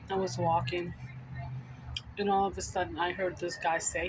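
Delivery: usual speaking voice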